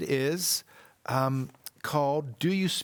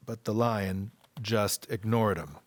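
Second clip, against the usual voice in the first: ordinary delivery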